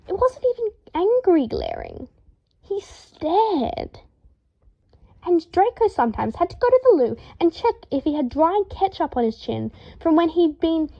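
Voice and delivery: rising, exasperated inner tirade; indignant rant. A narrator's reading. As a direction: rant it like a flustered Draco.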